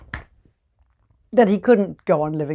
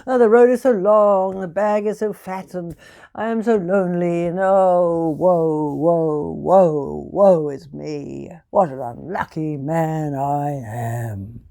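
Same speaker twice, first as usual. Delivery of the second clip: slow, louder woe-is-me wail; hammed-up self-pitying tinker; woeful moaning; 4 dB louder than usual. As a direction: moan each woe like a tragedy. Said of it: "so he was walking along going".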